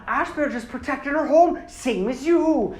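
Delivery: high, loud mock Irish lilt; Irish accent. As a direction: scold in a comic Irish accent. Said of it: "they would just make them have Irish accents".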